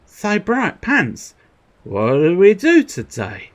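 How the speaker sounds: high, slow, goofy sidekick voice; Pinky impression. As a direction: drag it out like a dopey cartoon mouse. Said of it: "Pinky and the Pants".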